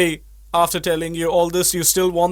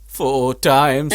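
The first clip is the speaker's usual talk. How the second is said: ordinary talk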